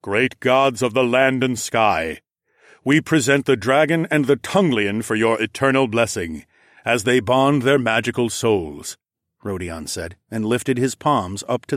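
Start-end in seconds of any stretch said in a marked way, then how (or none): 0.0-9.0 stately ceremonial invocation; lightly voiced solemn king.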